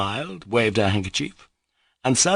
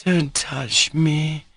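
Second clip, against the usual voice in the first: higher, very slow faltering plea; imploring; 9 semitones higher, much slower than usual. A narrator's reading. Grushenka faltering, imploring Mitya not to touch her. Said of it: "she faltered in an imploring voice".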